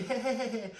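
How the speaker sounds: mocking kids. Schoolkids teasing him, high laughing taunt. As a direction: taunt like playground bullies. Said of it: "the kids would make fun of me".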